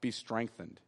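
be strengthened, leise und sanft gesprochen, fast gehaucht; soft passive. Shown as a demonstration. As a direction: say be strengthened softly, letting it fall away. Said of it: "You hear the difference between that?".

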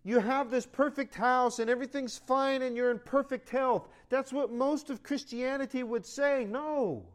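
loud, high preaching build; fiery preaching. Acted. Pastor preaching to his church, rising and loud. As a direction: preach it out to the congregation.

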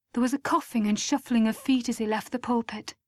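ordinary delivery

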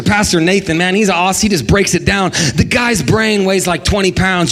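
normal talking voice

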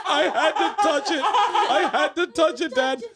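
put-on very high, slow childish voice through laughter; high kid voice cracking with laughter; laughing little girl. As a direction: giggle it out like a guilty kid. Daughter voiced confessing through laughter.

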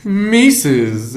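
high cartoon-dog drawl; Snagglepuss. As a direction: stretch it out like a cartoon character. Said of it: "he talks like this".

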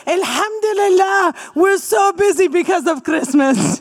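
cheerful put-on Fatma's accent; Fatma's accent, delighted. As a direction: chirp it in Fatma's accent, thrilled. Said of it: "I believe this is Fatma's accent".

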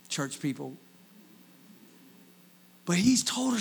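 ordinary talk

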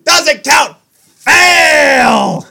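high, loud outraged calls; shouting. Real, not acted. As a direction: yell that it doesn't count. Calling foul on the tongue twister.

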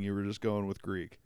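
ordinary delivery

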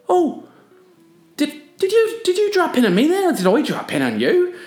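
very high, innocent, bewildered voice; confused surfer. Retold or imitated. Surfer who just burned Ken, feigning innocence in a high voice. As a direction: play dumb in a squeaky high voice. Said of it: "he was, like, really confused".

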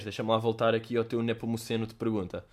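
ordinary delivery

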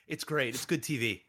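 ordinary delivery

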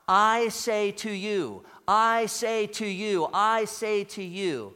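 "I say to you" slow and emphatic, stress on "I"; punched out. Retold or imitated. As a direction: punch out "I say to you" deliberately, leaning on "I". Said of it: "punching it out there".

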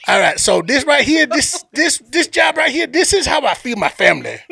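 emphatic Cajun guide pitching for tips; Sambo's Cajun accent. Retold or imitated. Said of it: "I'm gonna play this dude's accent".

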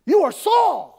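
high, loud accusing shout; crying out loudly. Acted. Woman confronting Saul who deceived her, crying out loudly. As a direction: cry out at the top of your voice. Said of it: "cried out at the top of her voice".

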